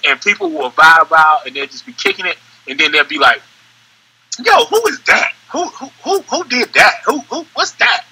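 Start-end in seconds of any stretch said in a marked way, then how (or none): 4.4-8.0 high-pitched, stammering excited questions; mock amazement, repeating the question word; imitating amazed listeners. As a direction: act blown away, voice shooting up. Amazed listeners asking who made it.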